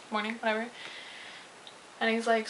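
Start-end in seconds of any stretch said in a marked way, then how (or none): none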